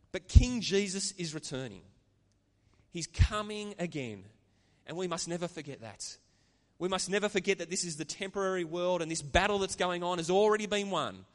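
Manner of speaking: fervent sermon climax; preaching. Acted. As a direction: proclaim it to the congregation. Preaching with force.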